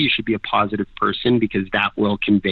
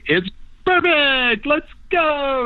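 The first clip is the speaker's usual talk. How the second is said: much higher, drawn-out and slow, eager; imitating a friend bursting in with great news; excited wake-up call; 13 semitones higher, much slower than usual. Andre wakes them up excited.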